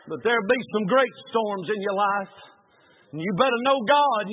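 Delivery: usual speaking voice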